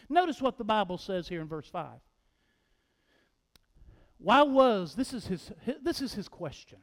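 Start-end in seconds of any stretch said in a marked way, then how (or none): none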